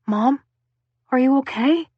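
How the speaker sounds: lightly voiced boy, worried; a son checking on his mother; worried son finding his mother crying; concerned teenage son. A narrator's reading. Harry's voice, boy.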